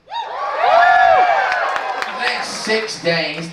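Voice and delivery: hammed-up, slow, high proclamation; drawn-out falsetto. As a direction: stretch every word up high like an opera cry. Comic dramatic repeat of line.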